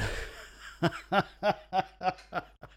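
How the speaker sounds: high burst of real laughter. Real, not acted. Cracking up at his own joke, high laugh.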